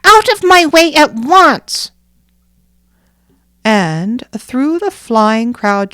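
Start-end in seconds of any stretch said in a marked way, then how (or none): 0.0-1.9 put-on high, loud child's command; bossy little girl. Nanina ordering the crowd, high loud bossy child. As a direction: order them aside at once. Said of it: "said Nanina".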